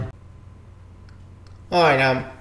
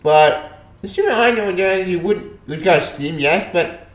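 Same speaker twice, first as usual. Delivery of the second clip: ordinary talk, nothing marked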